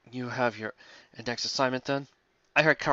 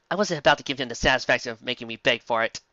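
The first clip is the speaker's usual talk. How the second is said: ordinary talk, nothing marked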